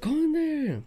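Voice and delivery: mom yelling. Retold or imitated. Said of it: "She was all yelling all loud".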